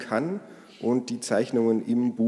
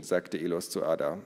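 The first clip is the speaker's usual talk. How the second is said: ordinary delivery, nothing marked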